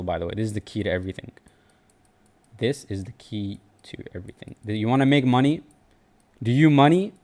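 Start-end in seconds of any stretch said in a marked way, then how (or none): 2.6-4.5 much softer, almost confiding, like a secret; hushed repeat. Shown as a demonstration. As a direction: drop the voice way down to let it sink in. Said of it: "this is very spiritual".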